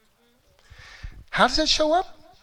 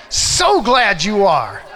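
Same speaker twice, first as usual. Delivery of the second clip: joyful loud greeting; 4 semitones higher, 8 dB louder, slower than usual. Shown as a demonstration. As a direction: call it out loud and high, then the answer slow. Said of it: "I want you to say".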